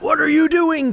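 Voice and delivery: sharp, high, loud snap; mock parent snapping at a kid; intense mode. Parent catching a kid misbehaving, sharp high snap. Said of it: "that intense mode".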